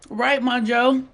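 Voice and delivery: normal talking voice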